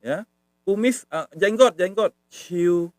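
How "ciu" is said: ciu smooth and level; rather soft tone. Shown as a demonstration. Teacher shows the beard word, ciu smooth and level. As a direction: hold ciu evenly on one note. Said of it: "Agak halus".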